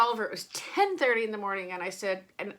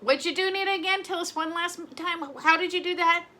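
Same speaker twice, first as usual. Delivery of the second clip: higher-pitched mock questioner; imitated audience question; 7 semitones higher than usual. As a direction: play an eager follower asking, pitched up. Voices the audience asking her secret.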